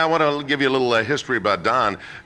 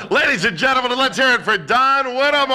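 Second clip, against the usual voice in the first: announcer intro; 12 semitones higher than usual. Real, not acted. Host introduces guest to audience.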